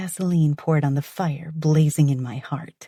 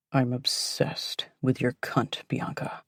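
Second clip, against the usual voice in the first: slow, low man's murmur; low male voice; 5 semitones lower, 4 dB quieter than usual. Acted. Narrator voices lover.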